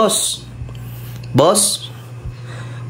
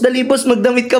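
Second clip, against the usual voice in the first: normal talking voice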